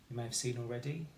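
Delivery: ordinary talk, nothing marked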